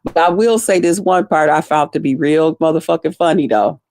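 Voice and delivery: ordinary talk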